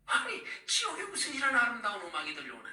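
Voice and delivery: high-pitched retold amazement; recounting a shock in a high, incredulous voice; astonished. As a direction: exclaim in startled disbelief, pitched well up. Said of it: "제가 깜짝 놀랐어요".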